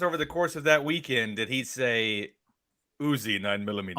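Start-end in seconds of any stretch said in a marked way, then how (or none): 3.0-4.0 low, deep movie-villain impression; lightly voiced Terminator line; Terminator quote. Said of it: "sent videos back and forth saying Uzi".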